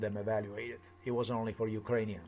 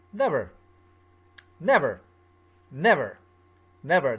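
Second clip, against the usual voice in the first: louder, clipped repeated refusals; mock Zelensky refusal; 6 dB louder than usual. Retelling how Zelensky stood up and refused him, mock flat refusals. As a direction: hammer each never like a slammed door. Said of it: "he just stand up and he went".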